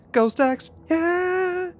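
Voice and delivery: high-pitched silly jingle voice; very high, playful hype voice; mock audio clip. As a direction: squeal it like a goofy sound clip. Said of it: "a ghost axe audio clip of".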